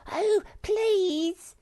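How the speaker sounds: a small creature begging for a picnic; pleading. A narrator's reading. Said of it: "entreated Sniff".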